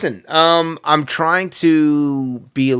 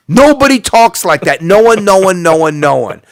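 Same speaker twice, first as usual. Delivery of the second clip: loud, high, emphatic protest; exasperated loud rant; indignant outburst; 6 semitones higher, 9 dB louder than usual. Real, not acted. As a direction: shout it down, hammering each repeat. Indignant rant about fake accent.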